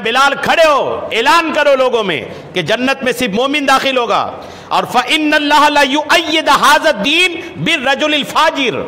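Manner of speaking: high, forceful retold order, stretched words; majestic command. Prophet in majesty tells Bilal to announce it, majestic command. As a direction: proclaim it with awe-filled authority. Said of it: "آقا جلال میں تھے".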